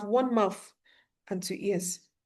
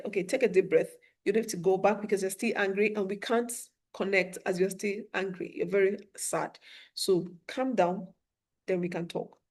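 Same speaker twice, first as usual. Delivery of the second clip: softer, gentle calming words; soothing teacher; 4 semitones lower, 9 dB quieter than usual. Retold or imitated. Teacher calming an angry student, soft and soothing. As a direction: talk a panting student down gently.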